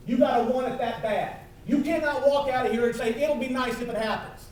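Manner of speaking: rally the crowd like a revival preacher; fired-up motivational sermon voice; preaching at full force. Acted.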